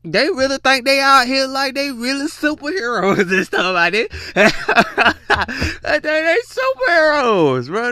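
loud, giggling disbelief, words breaking into laughs; can't get it out for laughing; laughing through it. Real, not acted. Mocking NPC streamers, loud and laughing.

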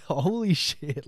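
slow, drawn-out mock shock with a laugh; retelling the angry queue to viewers, slow amused shock; stunned holy shit. Retold seeing angry queue, laughing.

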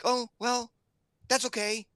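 put-on high kid voice taking it in stride; daughter's brave acceptance. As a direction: imitate a daughter shrugging off disappointment. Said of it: "and she's like".

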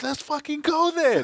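high, drawn-out fighting call; worked-up retold battle cry; heated challenge. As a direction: rise to the bait and dare him. Retells answering the insult.

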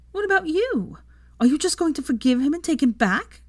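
put-on very high, upset girl's questioning; challenging daughter. A narrator's reading. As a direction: press your mother for an answer, high-pitched. Daughter challenges her mother.

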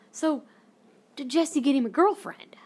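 mock eager, fishing question; hopeful prying mom. Mom hopes Jesse got a girlfriend, eagerly prying imitation.

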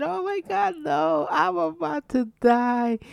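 high, slow panicked wail; panicked cry. Retold or imitated. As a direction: squeal it like you're dying. Retelling panic over swallowed bone.

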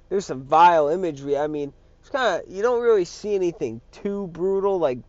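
normal talking voice

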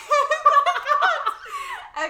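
put-on loud, high laughing valley girl. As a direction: shriek it like a ditzy LA girl. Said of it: "Is this a Cali accent?".

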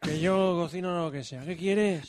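a hint of a shady cook muttering his offers; playing Tim Cook. Comedy sketch, playing Tim Cook to a cohost, mild mock tough guy. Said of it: "que soy Tim Cook".

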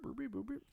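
high, soft trilled beeps; quick run of mouth-made machine beeps; little high-pitched sound effect; imitated beeping. Imitating the sound of removing items.

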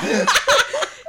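high loud burst of laughter. Real, not acted. Friends laughing at the robbery story, loud high laugh. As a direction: crack up loudly.

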